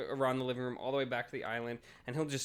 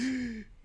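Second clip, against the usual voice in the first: sharp high gasp for air; mock gasp; 13 semitones higher than usual. Describing the humid day to a friend, high mock gasp. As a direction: gasp like stepping into a wall of heat.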